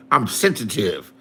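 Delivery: low, falling mock admission; sarcastic echo. Real, not acted. Answering a chatter's accusation, low sarcastic echo. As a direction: drop it low, as if conceding.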